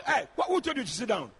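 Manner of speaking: ordinary talk, nothing marked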